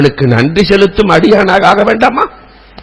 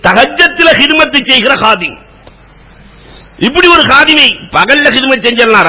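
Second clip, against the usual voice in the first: fervent preaching; 8 semitones higher than usual. Acted. Preacher retelling Bilal's question to the congregation, impassioned. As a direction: preach it with rising fervour.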